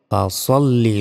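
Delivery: ফাসল্লি with heavy rounded "so", as "fa-SOL-li"; emphatic "sol" in ফাসল্লি; correct heavy sad. Shown as a demonstration. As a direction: say ফাসল্লি with a full, dark s. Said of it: "বলতে হবে".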